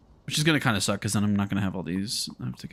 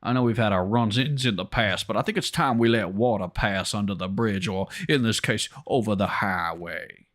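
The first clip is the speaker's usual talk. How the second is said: put-on southern drawl for a pompous official. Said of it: "also had that accent".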